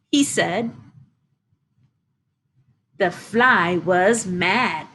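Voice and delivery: ordinary delivery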